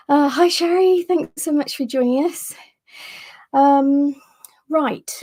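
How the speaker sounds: breathless, gasping between words; out of breath. Real, not acted. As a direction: talk while catching your breath. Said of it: "just gonna catch my breath here".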